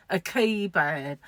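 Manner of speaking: "kebab" slow, stressed KEE-bab; ex-boyfriend's pronunciation. Retold or imitated. Vlogger imitating how her ex-boyfriend said kebab, slow. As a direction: drag out "kebab" like the ex did. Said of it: "wait for this, right".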